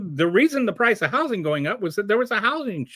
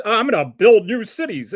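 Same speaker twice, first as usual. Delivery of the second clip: a light, louder mocking Trump voice; 5 dB louder than usual. Mocking Trump's promise to build cities, lightly voiced. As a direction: toss it off like a boastful promise.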